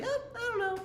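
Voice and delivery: high mock-clueless shrug; silly shrug voice. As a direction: play the test as a clueless cartoon. Voicing the undecided test.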